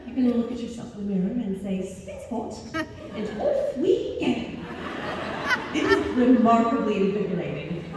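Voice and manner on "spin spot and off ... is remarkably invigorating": British accent. Shown as a demonstration. Mother rallying herself at the mirror, playful British accent. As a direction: sing out a jolly British send-off. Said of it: "I should speak in a British accent".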